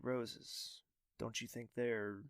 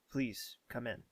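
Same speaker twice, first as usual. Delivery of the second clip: ordinary talk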